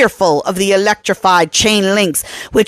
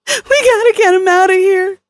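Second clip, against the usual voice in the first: tearful plea, voice breaking; crying; 12 semitones higher than usual. A narrator's reading. Kelly weeping as the T-Rex hurls itself at the fence, crying. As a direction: sob out the words. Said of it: "She started to cry".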